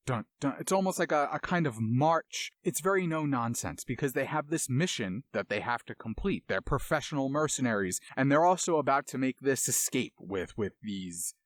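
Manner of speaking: ordinary talk, nothing marked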